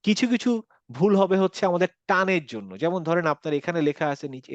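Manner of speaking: normal talking voice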